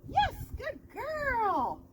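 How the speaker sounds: slow, high, sing-song praise for the dog; drawn-out praise. Real, not acted. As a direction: stretch out the praise to reward her.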